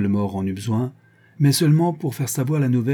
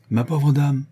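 a touch of mock sorrow, raised and louder; sorrowful exclamation; 5 semitones higher, 4 dB louder, slower than usual. A narrator's reading. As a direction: exclamer avec une tristesse de façade. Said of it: "s'exclama-t-il d'un ton mari". (une pointe de chagrin affecté, voix plus haute)

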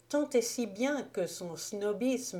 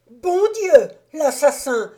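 a much higher, much louder strangled cry; choked terror; 8 semitones higher, 13 dB louder than usual. A narrator's reading. Jopley recognizes the murderer and cries out strangled. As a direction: cry out, throat tight with terror. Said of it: "fit-il d'une voix étranglée".

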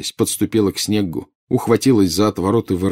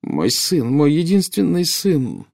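slow, high anguished wail; tearful; 9 semitones higher, 4 dB louder, slower than usual. A narrator's reading. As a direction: lament your child, pitch rising. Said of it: "покатились слёзы". (сквозь слёзы, протяжно)

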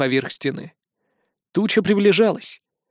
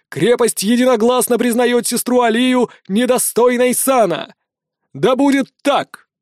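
town crier; 7 semitones higher, 3 dB louder than usual. A narrator's reading. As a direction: proclaim the verdict over the crowd. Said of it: "Глашатай продолжил".

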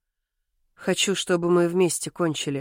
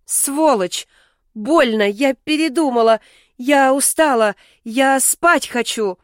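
higher, louder indignant complaint; pained protest; 5 semitones higher, 4 dB louder than usual. A narrator's reading. Heroine refusing her partner, pained indignant protest. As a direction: protest in pain, pitched up.

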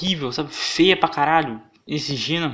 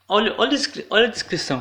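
indignação; 8 semitones higher, 4 dB louder, faster than usual. Real, not acted. Chama atenção indignado.

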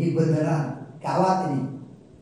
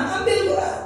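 laughing urging; 13 semitones higher, 4 dB louder, faster than usual. Real, not acted. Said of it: "Kembali lagi".

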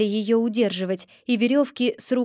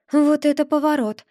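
surprised exclamation; 4 semitones higher, 3 dB louder than usual. A narrator's reading. Narrator's stunned exclamation.

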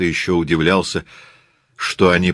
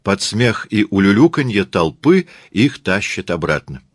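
usual speaking voice

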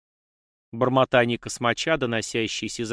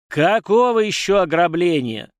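put-on loud, slow, higher furious old patriarch; furious outburst; 6 semitones higher, 8 dB louder than usual. A narrator's reading. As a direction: roar it out in rage. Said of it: "Патриарх яростно ударил тростью". (яростный крик)

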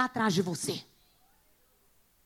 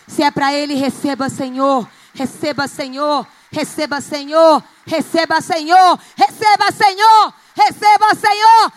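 building prayer call; 7 dB louder than usual. Real, not acted. Prayer building to loud calls.

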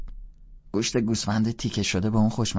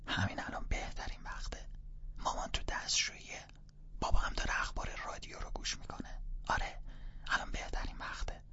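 urgent hushed whisper; 11 dB quieter than usual. Acted. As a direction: keep it down, parents are home.

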